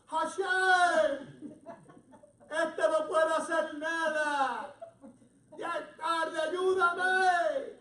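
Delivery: loud, high, drawn-out acted shout; desperate cry out. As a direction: cry out to heaven at full voice. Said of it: "Y empezó a clamar".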